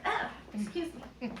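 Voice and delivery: plain reading voice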